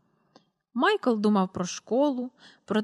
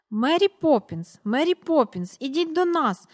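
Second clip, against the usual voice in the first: eager outburst; 5 semitones higher, 4 dB louder than usual. A narrator's reading. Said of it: "раптом вихопився Майкл".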